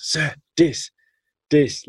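high, delighted "Sir, dis, dis"; imitating kid saying dis. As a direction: show off a cup of rice like a thrilled boy. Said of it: "nothing else".

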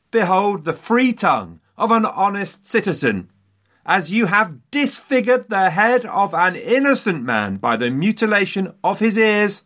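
high-pitched, declamatory mock yell; acted yell. Mutilated man denouncing the prisoners, theatrical high yell. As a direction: proclaim it high and emphatic, like a public denunciation. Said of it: "he yelled".

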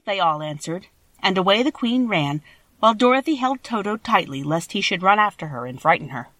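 ordinary delivery, nothing marked

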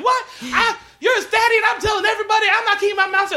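very high, rapid-fire hammed-up rant; mock furious yelling. Spurned girlfriend threatening to tell everybody, mock yelling. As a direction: imitate her shrieking threats in a rush. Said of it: "immediately start yelling".

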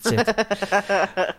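laughing. Real, not acted. Laughing while retelling the quote.

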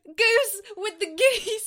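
high excited scream. Retold or imitated. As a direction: shriek it at the TV. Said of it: "I literally screamed at the T V".

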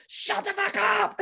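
high, sharp mock shout of shut the fuck up; retold shout. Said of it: "she'd go".

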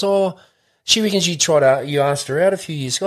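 high, excited mock mate; retold mate's voice. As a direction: pitch it up like a mate bursting with news. He goes.

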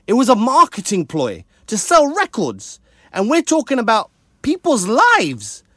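high-pitched, rising rant; worked-up, incredulous protest; impassioned outrage. Real, not acted. Impassioned rant about profiting from violence.

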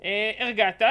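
ordinary talk, nothing marked

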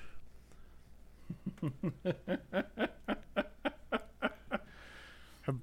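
breathy silent laugh at the joke; unvoiced laugh. Real, not acted. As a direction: laugh almost without voice.

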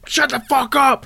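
imitating a sudden furious yell; mock outburst. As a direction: flip from nice to raging. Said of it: "And then it's like".

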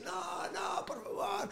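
high strained cry without much force; hammed-up plea while being shocked; mock pleading scream. Hero begging as Koshei zaps him, strained and high.

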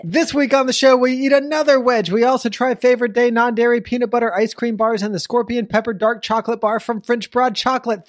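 high, pumped announcer voice; podcast host intro. Acted. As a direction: nail the tongue twister triumphantly. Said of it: "you almost made it".

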